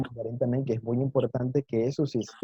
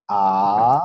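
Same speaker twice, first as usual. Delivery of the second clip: long, loud rising "A"; second tone; 5 semitones lower, 6 dB louder than usual. Shown as a demonstration. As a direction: draw out "A" as it climbs like a question. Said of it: "subir el tono de la voz de manera gradual".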